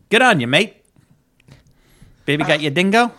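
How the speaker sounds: badly faked Australian accent; horrendous Australian accent. Host introducing the Down Under race, bad Australian accent. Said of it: "horrendous Australian accent".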